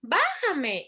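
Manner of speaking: put-on child asking. Shown as a demonstration. Toddler in the high chair wanting down, lightly voiced child. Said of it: "enséñale la palabra".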